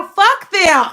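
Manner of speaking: loud, high, defiant curse; defiant outburst. Real, not acted. Telling off the people who held her back, loud and defiant. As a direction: spit it out at the doubters.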